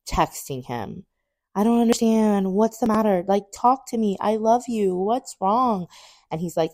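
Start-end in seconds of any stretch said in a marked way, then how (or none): 1.5-5.9 high, insistent plea; desperate pleading. Retold or imitated. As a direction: beg him for an answer, acted out. Retold desperate plea to boyfriend.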